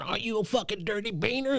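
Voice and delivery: lightly put-on voice of a rival player; mocked online gamer. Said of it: "So this dude's going".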